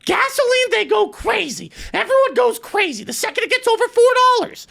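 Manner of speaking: high, worked-up ranting voice; mock Michael rant. Seatmate ranting to the storyteller about gas prices, high and worked up.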